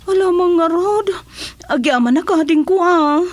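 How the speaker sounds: sobbing out gratitude, quick; tearful thanks. Acted. Tearful thanks for the neighbor's help. (agyaman a agsangsangit)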